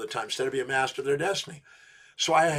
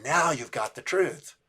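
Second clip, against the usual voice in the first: gentle confirmation; slower than usual. Retold or imitated. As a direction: let the truth land, slowly. Coach gently confirming tearful client.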